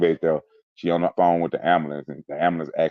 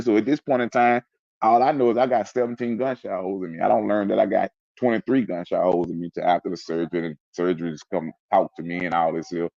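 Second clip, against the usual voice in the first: ordinary talk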